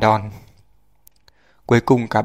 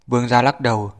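ordinary delivery

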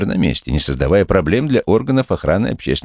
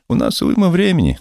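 high officer voice; 14 semitones higher than usual. Acted. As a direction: needle him, pitched up high.

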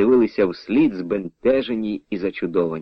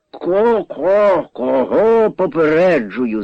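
high, drawn-out stammering whine; whining; 5 semitones higher, 3 dB louder, much slower than usual. A narrator's reading. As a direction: whine the question back, stuttering. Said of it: "заскиглив Дараган".

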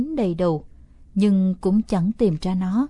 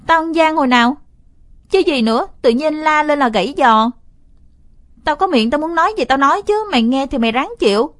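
loud, higher heated snapping; angry scolding; 6 semitones higher, 7 dB louder than usual. Acted. Brother yells back at his crying sister, loud angry scolding. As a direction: shout her down hard. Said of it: "Tôi quát mắt".

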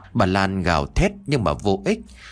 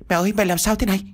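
alarmed son; 11 semitones higher, much faster than usual. Acted. Son finds mother tied up.

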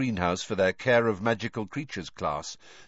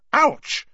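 pained yelp; 8 dB louder than usual. A narrator's reading. Burning pus hits her hands.

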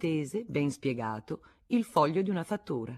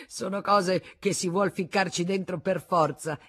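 Arnoux shrugging it off with forced cheer, a bit louder; 5 semitones higher, 6 dB louder than usual. Acted. Husband brushing off his wife's accusation, forced cheer. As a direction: put on a show of gaiety. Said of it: "ostentando gaiezza".

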